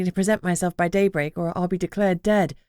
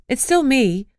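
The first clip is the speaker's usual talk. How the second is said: soothing; 6 semitones higher, 4 dB louder, slower than usual. A narrator's reading. Calming a frightened horse.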